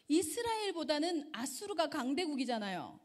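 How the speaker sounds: ordinary talk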